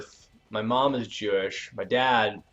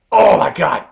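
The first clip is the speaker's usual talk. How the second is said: loud, high cry of disbelief; big over-the-top shocked outburst; shocked commentator shout; 5 semitones higher, 10 dB louder, slower than usual. Acted. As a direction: yell it like a ringside announcer. Reacting to a move in the game.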